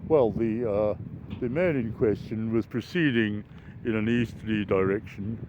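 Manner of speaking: mild mock police report; police officer impression. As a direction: read it out like a plodding copper in court. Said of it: "we'd always say things like".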